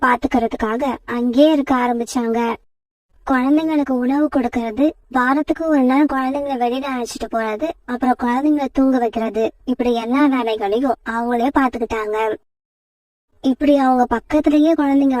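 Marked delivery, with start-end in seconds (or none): none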